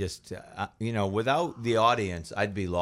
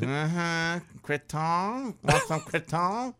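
grandmother offering creton; 8 semitones higher, much slower than usual. Retold or imitated. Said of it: "And then she goes".

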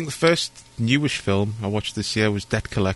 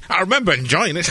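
higher, playful blurb for the box; jokey pull-quote with lifted pitch; mock review quote; 8 semitones higher than usual. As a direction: deliver it like a silly cover quote, about to laugh. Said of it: "there's a quote for the box".

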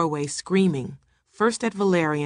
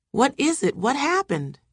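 high, pressing questions; alarmed questioning; 9 semitones higher than usual. A narrator's reading. Household presses the screaming woman, alarmed questioning. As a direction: demand to know, pitched up.

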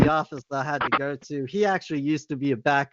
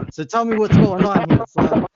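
stern principal; 6 semitones higher, 8 dB louder than usual. Retold or imitated. Principal voiced.

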